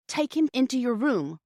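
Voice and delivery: ordinary delivery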